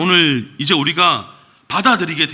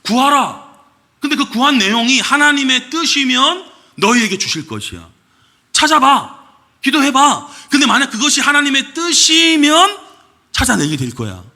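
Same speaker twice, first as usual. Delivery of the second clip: mock reworded scripture; 7 semitones higher, 3 dB louder than usual. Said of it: "이 말씀은 이렇게 바뀌어야 되는 거예요".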